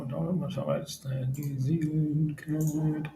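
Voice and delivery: muttering away from the microphone while hunting for a chart; half-heard, turned away from the mic; distant, quiet self-talk. Real, not acted.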